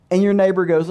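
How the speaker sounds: ordinary delivery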